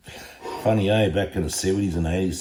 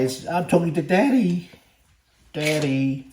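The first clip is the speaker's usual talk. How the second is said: higher put-on dog voice; voicing the dog; 8 semitones higher than usual. Owner voicing his dog saying it is talking to Daddy. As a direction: speak for the dog, a bit higher and sillier. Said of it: "you just say".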